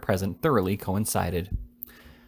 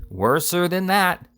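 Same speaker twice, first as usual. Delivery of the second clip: slow, raised "Worser than that", each word leaned on; louder repeat; 8 semitones higher, 5 dB louder, slower than usual. A narrator's reading. The fat boy repeats his news loudly into the deaf lady's ear. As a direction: drag it out loud for a deaf ear.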